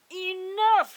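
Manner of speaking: loud royal "Enough!"; king's shout. Acted. King shouting at the misbehaving dragon, loud. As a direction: bellow it like a fed-up king. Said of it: "The king had had enough".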